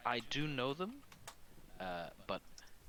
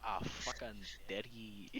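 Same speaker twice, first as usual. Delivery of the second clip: put-on Scottish accent. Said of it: "To emulate your Scottish accent".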